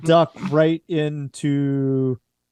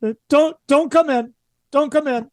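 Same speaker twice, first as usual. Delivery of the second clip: much higher and louder, a panicked plea; frightened pleading; 13 semitones higher, 5 dB louder than usual. Acted. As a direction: keep them out of the room at all costs. Character begs them not to enter.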